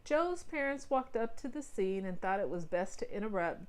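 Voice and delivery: ordinary delivery, nothing marked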